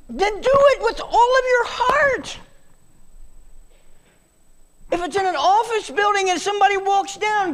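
very high, loud, ringing call; soaring exhortation. Acted. Preacher urging the church to serve, soaring high and loud. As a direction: lift the whole line up like a cry.

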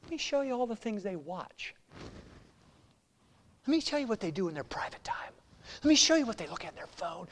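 soft, fast, sneering whisper; accuser's whisper. Acted. Devil accusing believers before the Father, fast whisper. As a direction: hiss the secrets like a tattletale.

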